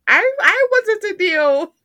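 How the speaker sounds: loud, high excited gush. Real, not acted. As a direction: squeal it out, swooning. Gushing over actor.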